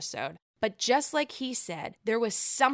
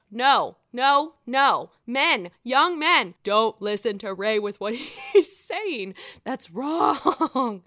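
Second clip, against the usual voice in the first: worked-up scolding; 6 dB louder, slower than usual. Real, not acted. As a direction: wag a finger and raise your voice. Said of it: "That worked me up".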